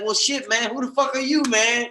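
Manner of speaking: mock indignant, playful impression; Bobby. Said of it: "that's what reminded me of Bobby".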